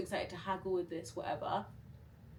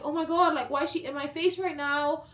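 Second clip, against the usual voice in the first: high, loud flustered panic; loud high frantic aside; 8 semitones higher, 7 dB louder than usual. Retold or imitated. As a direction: blurt it overwhelmed. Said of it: "I was just like".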